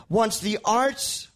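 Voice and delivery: normal talking voice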